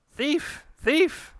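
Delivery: very high, loud alarm cries; shouting thief. Acted. Shopkeeper crying out at the thief, shrieking. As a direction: shriek it as she runs off.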